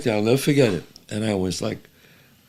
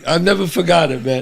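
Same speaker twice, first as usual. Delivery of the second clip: ordinary talk, nothing marked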